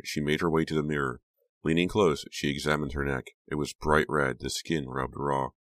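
ordinary delivery, nothing marked

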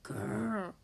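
low rolling grumpy growl; deep, grouchy "grrr"; bear growl. A narrator's reading. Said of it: "growled Bear".

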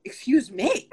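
starstruck, caught off guard, "Excuse me?" with a giggle; flustered surprise. Retold or imitated. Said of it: "And I was like".

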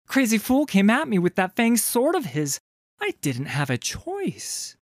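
high, put-on innocence; high-pitched, feigned protest of innocence; rehearsed lie. A narrator's reading. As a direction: rehearse the alibi in a high, smiling voice. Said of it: "rehearsing her lie".

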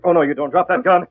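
loud, barked order to drop the gun; a tough hero barking at a crook; sharp command. Acted. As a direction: stop him cold with a loud command. Hornet stops Baker grabbing gun.

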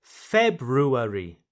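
slow February, FEB-roo-air-ee with both R's; every letter pronounced. Shown as a demonstration. Teacher shows learners every letter, February said slowly in full. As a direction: draw out February, sounding the R after the B. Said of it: "Here you pronounce every letter".

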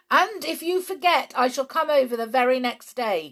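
high, loud call over distance. A narrator's reading. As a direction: call back across the water. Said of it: "called his wife".

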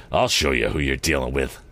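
low, controlled villain's threat; menacing anger. A narrator's reading. Morse starts towards Tom, menacing. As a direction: threaten him in a dropped pitch. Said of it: "his eyes blazing".

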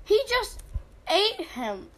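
high, loud surprised cry; shocked outburst. Real, not acted. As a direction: blurt it out in shock. Shocked at game event.

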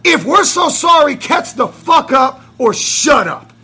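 full-voiced, high-pitched angry boast; defiant shouting. Real, not acted. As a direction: shout the rivals down, punching the key words. Shouted taunt at rival fans.

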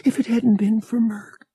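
breathy unvoiced whisper; hushed frightened whisper. A narrator's reading. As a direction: whisper it in shock. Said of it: "she whispered".

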